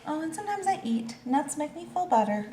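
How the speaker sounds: high squirrel voice. Acted.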